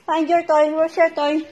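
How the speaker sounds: ordinary delivery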